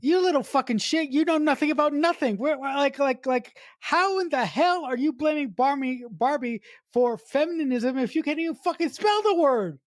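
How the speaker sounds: hypothetical outburst, pitched high; mock furious rant. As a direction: lay into the girl across the table. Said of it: "I wanna come across the table and say".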